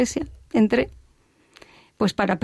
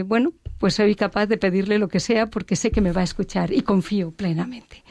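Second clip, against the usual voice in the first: normal talking voice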